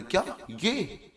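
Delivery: high, drawn-out shocked question; stunned exclamation. Shown as a demonstration. Teacher acting out shock for the class, stunned and slow. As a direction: gasp it out like you can't believe your eyes. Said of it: "exclamatory state में चले जाएं".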